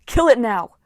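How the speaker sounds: loud furious command; angry shout. A narrator's reading. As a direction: bark it out angrily. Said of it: "an Ares girl shouted".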